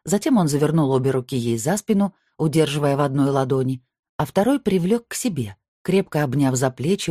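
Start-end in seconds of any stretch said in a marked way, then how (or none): none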